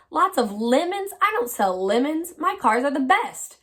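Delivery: storybook salesman loudly indignant; indignant bellow. A narrator's reading. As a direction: bellow in offended protest. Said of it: "he bellowed".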